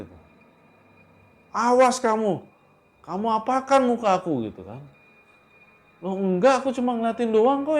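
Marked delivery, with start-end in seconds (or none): 1.5-4.5 high, loud acted outrage; acted threat, pitched way up; yelled accusation. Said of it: "Terus dia langsung teriak". 6.0-7.8 high, loud flustered denial; acted husband defending himself in a rush; startled protest. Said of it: "Anto kan kaget ya".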